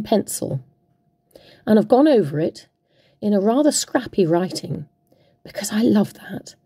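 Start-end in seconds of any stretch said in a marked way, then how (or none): 5.4-6.5 whispered aside. Real, not acted. Crafter admiring her scrappy lettering, whispered. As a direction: whisper it fondly to yourself.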